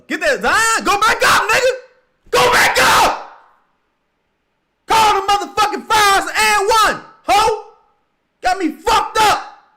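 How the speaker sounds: very loud, high screams at the refs; real enraged yelling; furious screaming. Real, not acted. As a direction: scream for the foul call. Screaming at the game.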